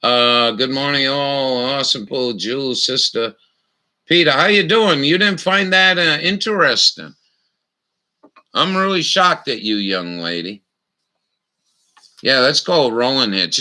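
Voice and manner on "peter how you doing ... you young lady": mock shock. Teasing viewers in live chat.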